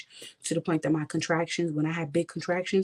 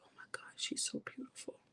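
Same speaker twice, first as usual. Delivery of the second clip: barely voiced, hushed whisper; whispered through tears; 20 dB quieter than usual. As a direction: whisper it, overcome at first sight. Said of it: "I'm just in tears".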